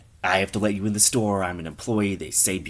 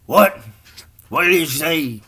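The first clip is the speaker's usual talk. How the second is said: loud, raised-pitch "what?"; hard-of-hearing shout; 9 semitones higher, 8 dB louder than usual. Acted. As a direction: call out as if you can't hear. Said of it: "his hearing is damaged".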